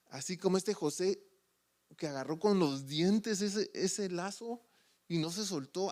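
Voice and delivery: ordinary talk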